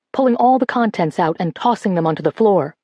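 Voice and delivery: usual speaking voice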